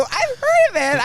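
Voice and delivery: high, loud, drawn-out reply; excited recognition. Retold or imitated. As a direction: reenact it with loud enthusiasm. Reenacts excited reply to actor.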